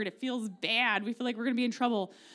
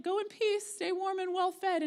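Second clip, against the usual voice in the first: much higher sarcastic sing-song; mock the empty blessing, sweetly; sarcastic sing-song farewell; 9 semitones higher than usual. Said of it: "that's a little sarcastic".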